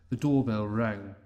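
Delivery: plain reading voice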